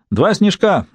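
mild shout. A narrator's reading. Julian calls an order to the barman, mild shout. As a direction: call the order out brightly, without full force. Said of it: "крикнул Джулиан бармену".

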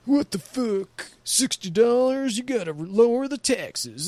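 high, slow, put-on whiny voice, far above own pitch; mocking whiny commenter. Mocking online commenters complaining about taxes, high whiny mock voice. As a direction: mimic a complaining stoner mocking his whine. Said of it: "They're like".

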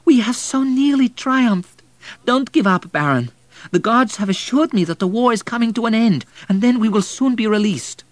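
loud, high desperate plea; impassioned cry, far above normal pitch; pleading boy. A narrator's reading. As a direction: beg urgently in a high boy's voice. Boy pleads with dying Baron.

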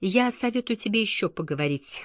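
ordinary delivery, nothing marked

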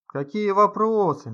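clamoring crowd. A narrator's reading. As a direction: call it out loud and slow. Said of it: "загалдели покойники". (гомонящая толпа)

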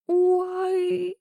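high, long wail of fright; sudden howl. A narrator's reading. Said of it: "внезапно взвыла".

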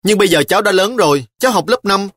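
a fifth-grader insisting she's grown up; sulky child. A narrator's reading. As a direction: protest like a sulking child. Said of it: "Trà Long vùng vằng".

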